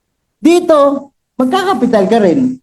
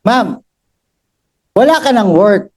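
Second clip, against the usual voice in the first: ordinary delivery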